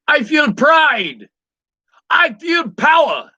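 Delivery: put-on loud, high, drawn-out Jamaican accent. Quoting a Cool Runnings pep talk, loud Jamaican accent. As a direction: belt it slowly in a Jamaican accent. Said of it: "tried to do it in a Jamaican accent".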